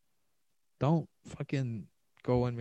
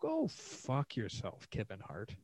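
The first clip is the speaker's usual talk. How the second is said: slow, drawn-out insult; slow contempt; 7 dB quieter, slower than usual. Real, not acted. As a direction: drag out the words with scorn. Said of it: "I'm like".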